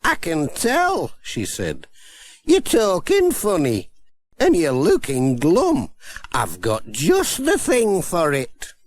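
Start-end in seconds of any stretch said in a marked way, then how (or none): 0.0-1.1 high, slow, kindly grandmother; kindly old granny. Acted. Granny Dryden. 2.4-8.7 put-on kindly old granny. Granny Dryden.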